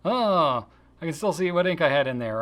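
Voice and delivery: loud, high Oh of disappointment; mock letdown; mock dismay. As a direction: act out finding the stain. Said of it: "mock disappointment".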